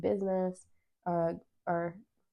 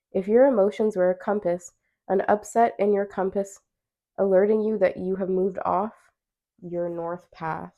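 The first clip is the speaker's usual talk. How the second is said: louder card reading voice; reading aloud; 7 dB louder than usual. Acted. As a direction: read the card text out clearly. Reads card text aloud.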